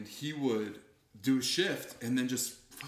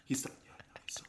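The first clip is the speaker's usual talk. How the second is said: hushed aside, barely voiced; quick covert whisper to a friend; 10 dB quieter, much faster than usual. Real, not acted. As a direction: whisper it so the caller can't hear. Aside during fake call.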